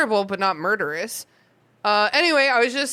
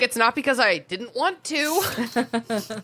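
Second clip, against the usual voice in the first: mock Snape; 3 semitones higher, much slower than usual. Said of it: "he's like".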